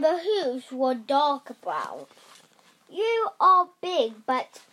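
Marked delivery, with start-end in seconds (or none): 2.9-4.6 lightly voiced cocky gingerbread man. A narrator's reading. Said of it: "he said".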